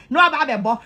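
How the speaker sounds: ordinary talk, nothing marked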